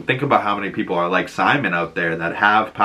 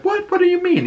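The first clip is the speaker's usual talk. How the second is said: very high mock disbelief; startled question. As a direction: squeak out a retold startled question. Young Simon's startled question.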